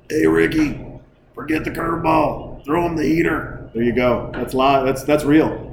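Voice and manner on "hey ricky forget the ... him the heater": higher-pitched coach calling to his player; coach impersonation. Shown as a demonstration. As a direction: do a baseball coach calling out instructions. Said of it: "I got the coaches one down".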